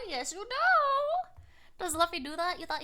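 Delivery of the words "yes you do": high, slow, stretched playful coo; sing-song squeaky teasing. Real, not acted. Teasing answer, repeated higher.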